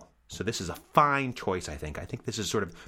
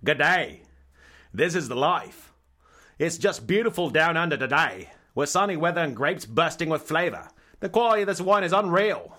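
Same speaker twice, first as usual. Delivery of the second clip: louder, higher hammed-up Australian accent; mock Aussie accent; 7 semitones higher, 5 dB louder than usual. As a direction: ham up an Australian blurb voice. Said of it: "Very Aussie".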